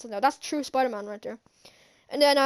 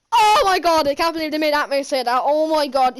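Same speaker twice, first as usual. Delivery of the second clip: loud burst of fan excitement; loud opening exclamation, still buzzing after; amazed outburst; 2 semitones higher, 6 dB louder than usual. Real, not acted. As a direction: blurt it out, overwhelmed with excitement. Amazed by Aunt May's line.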